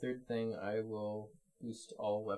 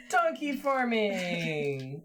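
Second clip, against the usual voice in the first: high, loud, slow delighted cry; gasp, then cry it out with glee; excited gasp; 9 semitones higher, 8 dB louder, much slower than usual. Real, not acted. Gasps at the donkey card.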